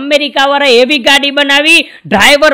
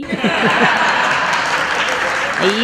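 breathy laugh; 3 semitones lower, 6 dB quieter than usual. Real, not acted. Own laugh after the joke.

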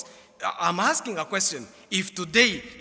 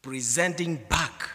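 hushed reverent close; 7 semitones lower, slower than usual. Acted. Softer closing of sermon.

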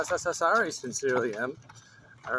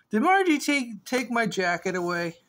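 surprised; 7 semitones higher, 3 dB louder than usual. Real, not acted. Surprised question, loud and high.

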